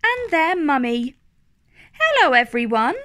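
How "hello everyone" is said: cheerful greeting. Acted. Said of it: "cries Rebecca".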